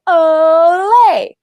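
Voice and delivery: high, loud olé with a lifted voice; mock cheer. Server mocking the taco pizza cheer, loud and high. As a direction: belt it like a party chant. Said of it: "lead everyone in going".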